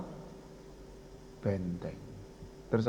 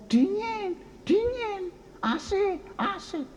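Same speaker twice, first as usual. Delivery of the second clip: high, drawn-out mock call; contrasting cool cry; much slower than usual. The one shaded by clouds answering the sufferers, high imitated call. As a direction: imitate someone calling out in comfort, pitched far up. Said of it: "Dia justru ngomong".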